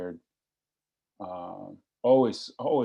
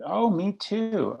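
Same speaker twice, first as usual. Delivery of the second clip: gushing phone lady; 12 semitones higher, much slower than usual. Retold or imitated. Imitating the cheerful phone lady.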